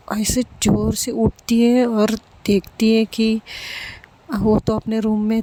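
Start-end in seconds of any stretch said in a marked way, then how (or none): none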